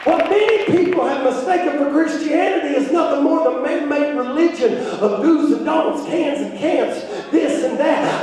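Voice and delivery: driving sermon climax; full-force preaching. Acted. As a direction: preach with urgent, ringing conviction.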